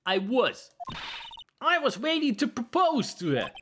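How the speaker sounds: squeaky, excitable puppet witness; high-pitched nervous puppet. Acted.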